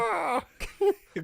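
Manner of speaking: high cawing crow cry; shrill mock crow call. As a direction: caw like a crow calling. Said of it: "Call for the crows".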